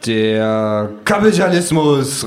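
zögernd gedehnt, dann herausplatzend; ins Blaue geraten. Acted. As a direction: langsam raten, dann laut werden. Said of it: "rate ich ins Blaue hinein". (gedehntes Raten)

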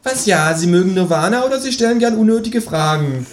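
high, pressing kangaroo question; high-pitched irritated kangaroo; impatient kangaroo. Acted.

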